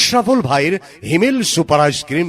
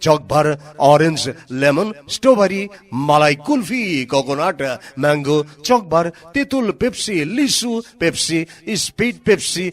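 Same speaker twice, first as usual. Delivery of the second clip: ad announcer; 5 semitones higher than usual. Acted. Loud mic ad promo.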